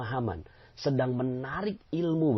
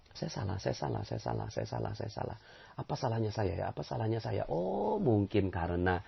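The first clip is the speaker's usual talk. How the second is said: murmured, much softer; soft introspection; 4 semitones lower, 6 dB quieter than usual. Shown as a demonstration. Preacher models self-introspection, murmured softly. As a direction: ponder it under your breath. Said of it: "Jadikan ini alat introspeksi".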